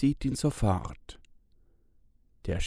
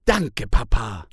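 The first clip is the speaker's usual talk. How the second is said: put-on louder happy girl cheering her dad; jubilant thanks; 8 dB louder than usual. A narrator's reading. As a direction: cheer with relief. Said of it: "jubelt Stella". (Stella jubelt laut und glücklich)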